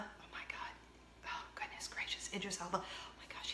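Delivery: breathless, barely voiced awe; swooning whisper. Real, not acted. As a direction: whisper it as if overcome by a crush. Swooning over an actor's accent.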